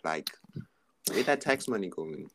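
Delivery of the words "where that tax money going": ordinary talk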